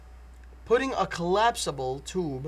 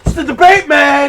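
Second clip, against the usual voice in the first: higher, louder excited yell; hyped shout; 9 semitones higher, 13 dB louder than usual. Acted. Debater yelling at the host about the debate. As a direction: yell it to pump up the crowd.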